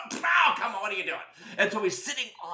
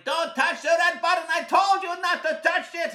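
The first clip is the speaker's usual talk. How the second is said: usual speaking voice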